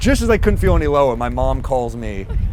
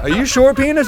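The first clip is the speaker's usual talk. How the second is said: high, squeaky unsure question; doubtful question; 10 semitones higher, 3 dB louder than usual. Retold or imitated. Man nervously questioning his own penis, high pitched. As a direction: ask nervously, voice jumping up. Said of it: "My dick is like".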